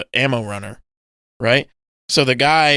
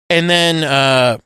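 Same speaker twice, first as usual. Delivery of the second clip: usual speaking voice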